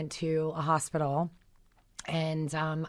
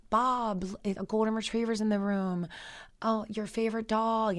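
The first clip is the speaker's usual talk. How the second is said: wife's tender words; 6 semitones higher than usual. Retold or imitated. Wife tells husband about dog.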